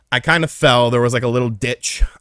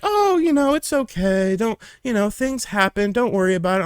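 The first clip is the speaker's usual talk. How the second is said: comforting parents; 8 semitones higher than usual. Retold or imitated.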